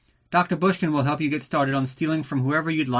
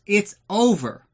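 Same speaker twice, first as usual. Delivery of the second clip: higher, louder and slow, mock-tragic; dramatic finality; 8 semitones higher, 4 dB louder, slower than usual. Real, not acted. Host declaring the Bond news dead, slow mock-tragic. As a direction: drag it out like a melodramatic ending.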